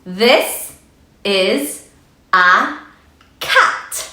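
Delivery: slow reading with a pause after each word; careful choral reading. Shown as a demonstration. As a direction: read one word at a time for the kids. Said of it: "Let's read a sentence together".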